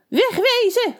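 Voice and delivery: high, screeching witch. A narrator's reading. The witch screeching at the townsfolk through the door, very high. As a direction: shriek it like a cranky witch. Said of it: "hoorden ze haar krijsen".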